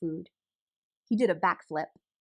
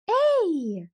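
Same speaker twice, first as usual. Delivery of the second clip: high, loud, surprised "hey"; startled shout; 7 semitones higher, 6 dB louder than usual. Acted. Man woken by hamster on his chest, startled shout. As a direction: yelp it out as if jolted awake. Creature lands on sleeping man.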